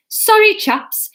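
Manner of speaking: posh Norman voice. Acted. Norman voiced, high put-on.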